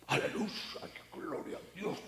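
a proud pastor putting on a hushed holy voice; put-on pious voice. Preacher mocks pastors who fake a whispered holy tone. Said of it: "¿Por qué tienes que cambiar la voz?".

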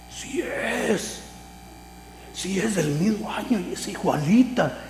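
high-pitched, hushed excitement; retold delight, high and held in; thrilled discovery. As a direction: squeal it softly, as if finding treasure. Retells thrilled discovery in coin book.

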